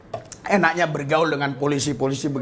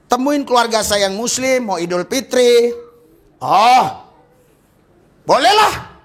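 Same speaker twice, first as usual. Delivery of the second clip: high, loud shouted sermon; fiery preacher voicing a prayer; full-force preaching; 7 semitones higher, 5 dB louder than usual. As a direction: preach it like a call to heaven. Preaching dialogue with Jesus.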